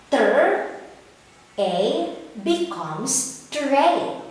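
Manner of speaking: slow sounding out, then "tray" said whole; blending sounds. Shown as a demonstration. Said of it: "As you read this word".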